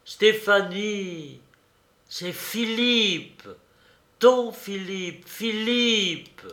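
high, drawn-out pleading cries; despairing call. A narrator's reading. Colonel begging Stéphanie to know him, despairing drawn-out call. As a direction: call slowly and desperately, stretching each name. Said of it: "s'écria le colonel au désespoir".

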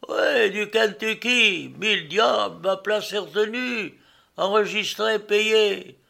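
high, loud outraged protest; indignant shouting. A narrator's reading. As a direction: shout your complaint up at the captain. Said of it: "il criait".

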